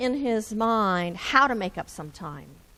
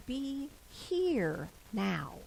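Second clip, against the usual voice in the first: calm self-reminder; 8 dB quieter, much slower than usual. Shown as a demonstration. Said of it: "to tell yourself".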